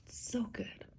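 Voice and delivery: hushed whisper, choked up. Real, not acted. Vlogger whispering to viewers late at night, moved. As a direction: whisper so as not to wake anyone.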